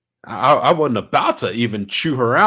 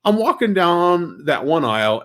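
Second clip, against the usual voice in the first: usual speaking voice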